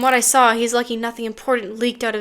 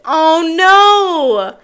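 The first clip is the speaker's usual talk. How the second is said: loud dismayed cry; 5 semitones higher, 7 dB louder than usual. Real, not acted. Reacting to spoiler.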